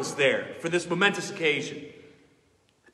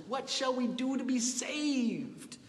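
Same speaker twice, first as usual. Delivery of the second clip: soft, higher pleading question; hushed plea; 7 semitones higher, 7 dB quieter than usual. Retold or imitated. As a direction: murmur it like a stricken listener. Question revival hearers ask.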